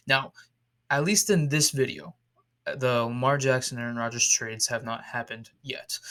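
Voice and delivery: usual speaking voice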